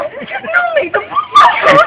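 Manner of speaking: high, loud, tearful wailing of a desperate mother; mother sobbing to the policeman about the stolen money. Acted. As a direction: wail it out like a distraught mom begging for help. Mother begging son, crying.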